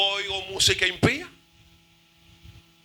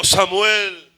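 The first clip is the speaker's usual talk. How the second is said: loud, booming call of a name; Jehovah calling; 9 dB louder than usual. Acted. God calls the boy once more, loud and resounding. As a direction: boom the name out, stretching its end. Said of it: "Y volvió y llamó".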